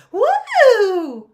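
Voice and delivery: high, loud whoop with a laugh; excited woo. Retold or imitated. Whooping at the Grammys dress moment, loud and high. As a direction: let out a delighted whoop.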